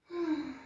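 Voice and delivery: long sigh, breathed out through the mouth; relieved sigh. Real, not acted. Sigh out, as just cued.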